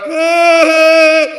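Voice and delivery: high mock crying wail; a quick high sob sound; imitated high wail. Comic imitating crying girl, high mock wail. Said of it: "she's crying like".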